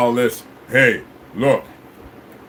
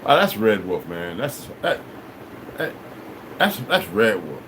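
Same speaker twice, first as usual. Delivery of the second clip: imitated onlookers; 11 semitones lower, 7 dB quieter than usual. Imitates onlookers' reaction to him.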